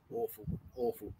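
very low, near-whispered murmur; hushed dismay. Real, not acted. Streamer recalling the killing fields in Cambodia, hushed. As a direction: let the words sink almost to breath.

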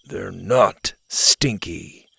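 slow, low, flat and annoyed; deadpan boy voice, mildly annoyed; irritated monotone. Acted. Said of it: "with as much irritation as his monotone voice could".

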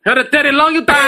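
put-on high, loud joking accent; mock Chinese accent. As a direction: ham up an accented catchphrase. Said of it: "you got Chinese".

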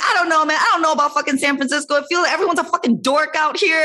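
high-pitched comic griping; hammed-up, high venting; exasperated rant. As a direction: re-enact a whiny complaint, laughing in the middle. Re-enacting her complaints about San Francisco.

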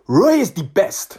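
put-on emphatic, drawn-out Nigerian accent; imitated Nigerian fan. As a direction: declare it devotedly in a Nigerian accent. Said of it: "what do you think of Roy Hodgson".

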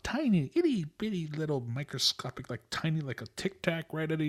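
high, playful itty-bitty voice; teeny-tiny voice. Pretend. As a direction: play up the tininess, pitched high. Playing up the small stuff segment.

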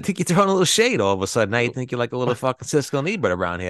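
hammed-up taunting a friend over shade; mock accusation. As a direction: needle him with fake outrage.

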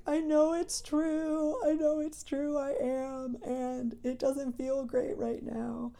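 high, tearful retold weeping. Telling family at the weekend, tearfully high and weeping. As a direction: relive the tears of that moment, voice high. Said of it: "I just started to weep".

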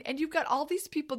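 ordinary delivery

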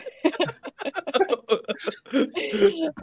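high bursting giggle at own bad accent; embarrassed high-pitched laugh. Real, not acted.

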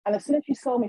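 ordinary talk, nothing marked

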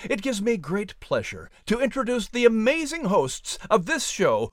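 plain reading voice

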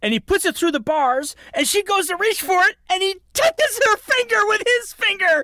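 high, excited build to a cry; thrilled outburst. Real, not acted. Film fan reliving the finger touch for co-host, thrilled. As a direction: gush with goosebumps, voice climbing to a squeal.

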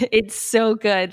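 laughing it out, slowly. Real, not acted. Guest delighting in her favorite technique, laughing. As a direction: chuckle through the words.

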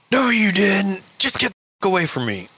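high-pitched mock-furious biker; imitating the biker's irate dismissal; retold angry retort. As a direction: contradict hotly, pitch shooting up. Retold biker contradicting, telling off.

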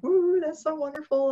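high, excited woo of praise; congratulating. Retold or imitated. People around the excited woman congratulating her, high and bright. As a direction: cheer a friend's news.

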